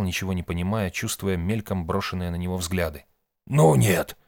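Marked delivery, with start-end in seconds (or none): 3.5-4.2 loud, emphatic no; decisive refusal. A narrator's reading. Old man rejecting the ritual idea, resolute. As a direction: shut it down firmly, raised voice. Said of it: "решительно сказал Шептун".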